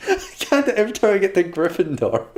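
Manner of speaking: ordinary talk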